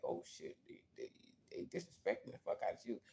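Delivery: quick hushed whisper; whispered aside. Retold or imitated. Warning himself they disrespect him, fast whisper. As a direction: rush it under your breath. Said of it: "I was like".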